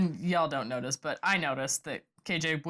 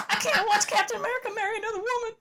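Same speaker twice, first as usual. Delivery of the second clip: much higher Southern belle voice, mock-weepy; Jonna impression; faster than usual. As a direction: do a hammed-up weepy Southern belle, near tears. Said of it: "Oh, your Southern Belle?".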